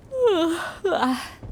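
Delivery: long drooping tired sigh; weary sleepy sigh. Acted. Citlali worn out after a sleepless night, heavy sigh. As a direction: sigh like you can barely stay awake. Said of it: "can barely keep my eyes open".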